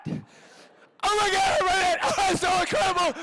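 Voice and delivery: very high, loud laughing shriek; ecstatic shouting. Retold or imitated. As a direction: scream with joy at the big reveal. Bursting with excitement over script.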